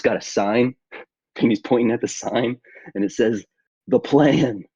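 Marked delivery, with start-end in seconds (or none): 3.9-4.6 urgent reminder. Acted. Sign shown, high far voice.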